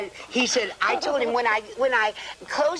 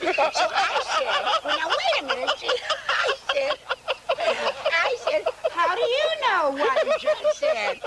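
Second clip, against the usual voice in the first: laughing while telling; 12 semitones higher than usual. Real, not acted. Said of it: "laughing while telling".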